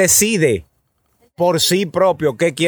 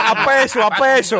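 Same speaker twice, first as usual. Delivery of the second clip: singsong market price calls; 7 semitones higher than usual. Retold or imitated. Street vendor calling out prices.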